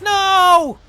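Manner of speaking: very loud, high yell of dismay; sudden high, loud no; anguished shout. Real, not acted. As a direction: yell it as progress falls apart. Game progress suddenly undone.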